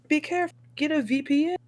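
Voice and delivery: high-pitched imitated caution; mock warning. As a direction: warn as the worried people you're imitating. Imitates people warning them.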